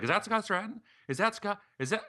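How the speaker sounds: high-pitched imitation of astonished questioning; incredulous woman. Guest imitating the woman asking another diner about him, incredulous. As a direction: ask it high, in mock disbelief.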